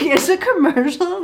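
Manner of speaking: high, disbelieving echo; imitating a baffled girlfriend; mock incredulity.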